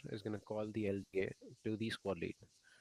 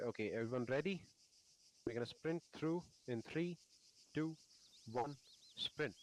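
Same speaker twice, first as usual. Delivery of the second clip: higher, brisk countdown call; squad countdown; 3 semitones higher, 5 dB quieter than usual. Shown as a demonstration. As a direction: count the team down to the sprint.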